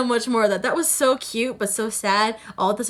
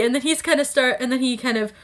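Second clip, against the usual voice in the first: ordinary delivery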